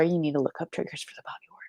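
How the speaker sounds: urgent whispered warning. Real, not acted. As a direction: hush it like a secret. Whispered emphasis.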